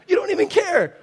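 high, loud hammed-up protest; mock outraged accusation. Speaker accusing an inattentive listener, mock outraged. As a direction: throw out a shouted mock accusation. Acted quarrel over not listening.

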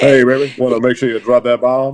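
hint of [name], teasing; [name] impression. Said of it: "Still can't stop doing the [name] accent".

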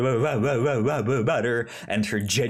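ordinary talk

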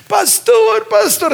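high, excited calling out; joyful shout. Retold or imitated. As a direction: shout his name up high with joy. Happy husband calls the pastor.

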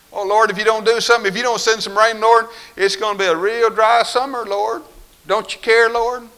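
high, loud, fast whiny pleading; mock frantic prayer. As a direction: ham up a panicky begging prayer. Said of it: "Man, I've been there".

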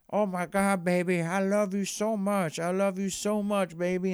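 high, gushing mock wife; mock lovesick wife pouring it out. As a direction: coo it over the top, voice up high. Wife gushing at Jax's confession.

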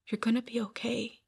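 soft, breathy reassurance; retold soothing whisper. Minister comforting speaker lying there crying, retold soothing whisper.

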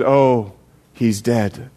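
low, subdued storytelling voice; grave news. Acted. Pastor breaks grave news.

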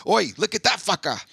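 high-pitched mock Australian heckle; Aussie commentator. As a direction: imitate a cheeky Aussie voiceover in Australian accent. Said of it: "does the voice of them".

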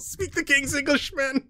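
high-pitched Scottish complaint; thick Scottish accent. Acted. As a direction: plead it high in broad Scots. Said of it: "replies with a thick Scottish accent".

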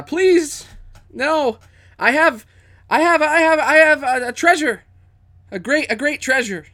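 high, loud frantic begging; terrified pleading. Acted. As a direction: beg for your life, terrified. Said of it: "She is terrified".

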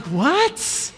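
high puzzled what?; confused adult. Retold or imitated. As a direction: sound baffled. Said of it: "And they're like".

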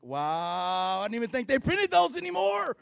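hammed-up shock at a checkbook; mock disbelief. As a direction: shopper stunned by a checkbook, loud high disbelief. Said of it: "You're like".

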